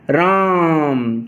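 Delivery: राम with the आ held three beats; prolonged vowel demo. Shown as a demonstration. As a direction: stretch राम very slowly. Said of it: "तीन मतलब कि मात्रा का समय".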